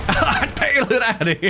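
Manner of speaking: laughing echo. Retold or imitated. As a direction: repeat the line through a laugh. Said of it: "echoes Ghost's line".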